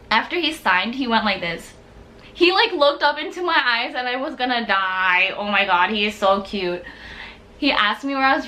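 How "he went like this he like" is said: usual speaking voice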